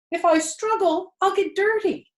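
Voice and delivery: a fussy piglet fretting; fretful pig. A narrator's reading. Wilbur worrying to the sheep, high and fretful.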